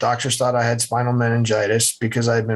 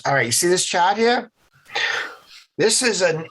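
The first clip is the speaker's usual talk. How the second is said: higher-pitched New England doctor imitation; nasally New England accent; 5 semitones higher than usual. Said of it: "had that nasally New England accent".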